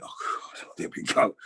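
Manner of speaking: whispered, dismayed at himself; whispered aside. Retold or imitated. Young striker fluffs his first touch, whispered dismay. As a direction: breathe it out under your breath.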